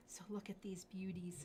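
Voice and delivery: whispered admiration; awed whisper. Real, not acted. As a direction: breathe it like a secret.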